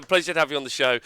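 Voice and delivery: ordinary talk, nothing marked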